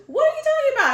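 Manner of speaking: shrieked, very high and loud mock outrage; mom impression. Daughter mimics mom's phone reaction, hammed-up loud disbelief. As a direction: blow it up into a loud, squawking mom. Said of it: "if you, anybody knows my mom".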